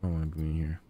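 stuck in the daycare, low sulky grumble. Real, not acted.

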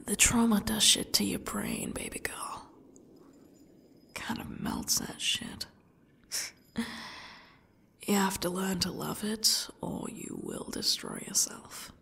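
quiet, unhinged, broken whisper. Acted. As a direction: whisper it like a dark confession. Captor confessing past trauma.

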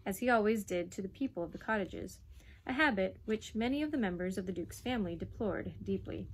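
plain reading voice

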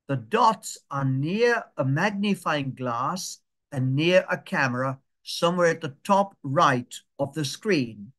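slow, spaced-out walk-through; slow instruction. Shown as a demonstration. Teacher guiding the class to the dots before pressing, slowly. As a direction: take it phrase by phrase so they can follow. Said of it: "I'll say it slowly".

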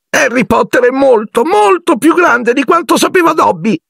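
very high, tearful elf voice; Dobby, sobbing. A narrator's reading. Elf hugs Harry and praises his greatness, sobbing. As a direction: squeak it out, overcome, as if sobbing. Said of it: "singhiozzò".

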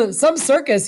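amused, high and dry; appreciative. Acted. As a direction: relish the spectacle lightly. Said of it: "he said appreciatively".

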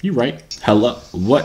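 normal talking voice

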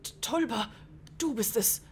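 theatrical, halting amazement; lightly acted storybook elder's surprise; astonished game character. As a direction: stammer in stagey disbelief. Reads startled character's lines.

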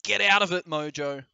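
loud, high scolding call; calling out sharply. Real, not acted. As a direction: shout it to shoo someone off. Calling off Mojo mid-stream.

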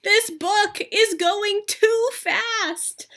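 high, slow, whiny mock sob; mock crying. Gerald upset that the book is ending, mock crying. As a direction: wail about the book ending.

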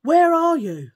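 husband calling out loudly into the night; shout. A narrator's reading. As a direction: yell up the road for the drunk man.

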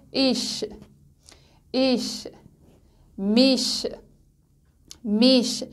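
ایش، میش ending in a soft German ich-sound. Shown as a demonstration. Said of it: "ش نرم هستش".

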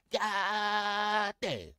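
high, long-held holler; admiring exclamation. Real, not acted. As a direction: let it soar, stretching the first syllable. Exclaims over Southern girls.

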